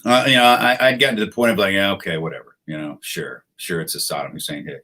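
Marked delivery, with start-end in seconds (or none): 1.7-4.8 low, soft, sceptical shrug in the voice; resigned, unconvinced muttering; jaded dismissal. Retold or imitated. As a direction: toss it off as if you've heard it all before. Acting out jaded disbelief.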